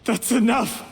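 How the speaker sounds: desperate shout of that's enough; angry outburst. Acted. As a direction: snap to silence the accuser. Cornered man cutting off accuser.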